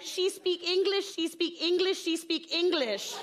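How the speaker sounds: high, rhythmic repeated phrase; insistent repeating mother. Acted. Mother insisting to teacher about daughter, acted insistence. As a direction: drive it home, high and chant-like. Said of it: "She just repeats phrases".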